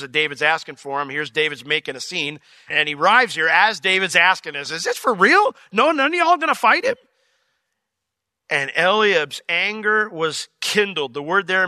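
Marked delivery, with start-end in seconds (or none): 4.5-7.0 high, disbelieving question; incredulous David. Acted. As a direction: ask it like you can't believe it. David asks soldiers in disbelief.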